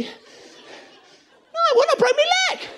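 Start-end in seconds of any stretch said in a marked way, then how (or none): 1.6-2.7 high, loud retort; mock shout. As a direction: snap back at them.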